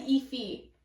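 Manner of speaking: "Efi" said EE-fee; heard pronunciation. Shown as a demonstration. Reader repeats how she has heard the name, alternate pronunciation. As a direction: say "Efi" ending in a long fee. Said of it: "I've also heard it's like Efi".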